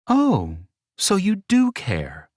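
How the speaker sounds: high, slow mocking tease; teasing. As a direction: toy with him sweetly. Teasing him.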